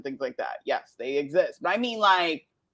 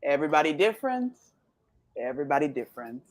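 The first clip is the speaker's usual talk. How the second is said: Jamaican accent; much faster than usual. Shown as a demonstration. Woman talking herself down with a saying, Jamaican accent. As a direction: repeat it in a Jamaican accent, winding down. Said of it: "like, a nice Jamaican accent".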